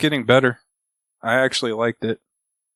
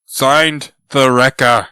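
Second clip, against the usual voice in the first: louder, dramatic sign-off; villain signature; 7 dB louder, much slower than usual. Retold or imitated. Reading out the Wrecker's signature, loud and dramatic. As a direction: announce the villain's name. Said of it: "then the note".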